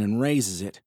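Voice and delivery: usual speaking voice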